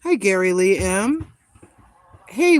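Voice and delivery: normal talking voice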